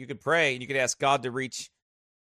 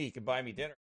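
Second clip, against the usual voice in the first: much softer, muttered aside; quiet aside; 11 dB quieter, faster than usual. Shown as a demonstration. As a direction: drop the voice for a joke aside. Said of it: "Stop by and he can buy me dinner".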